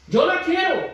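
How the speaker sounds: high, emphatic mock protest; insistent declaration. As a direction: blurt it high as the insistent husband. Same words repeated insistently.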